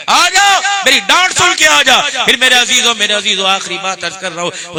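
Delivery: loud, high, impassioned sermon; fiery preaching. Acted. Preacher scolding and moving the congregation, loud and fervent. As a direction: thunder it from the pulpit.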